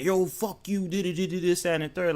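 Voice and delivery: high, put-on voice for a fake blow-up; mock angry comeback. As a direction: play a pretend hothead mouthing off, high-pitched. Acting out getting mad.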